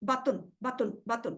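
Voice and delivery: باتن repeated with a short, unstretched با; باتن as ba-tun, short a. Shown as a demonstration. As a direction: keep the ba brief in each باتن. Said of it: "با کو کھینچنا نہیں ہے".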